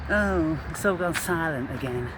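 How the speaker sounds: ordinary delivery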